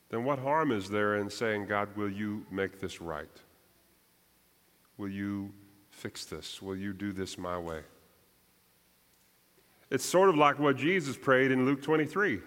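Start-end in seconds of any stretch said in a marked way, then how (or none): 1.7-7.8 soft pleading prayer. Pretend. Preacher voicing a believer's specific request to God. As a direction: murmur it like a private plea.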